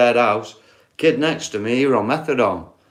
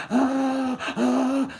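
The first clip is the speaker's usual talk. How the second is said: mock groan; 13 semitones higher, 5 dB quieter than usual. Sick housemate groaning on stretcher.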